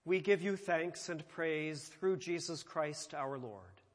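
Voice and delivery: ordinary talk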